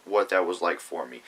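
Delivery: ordinary talk, nothing marked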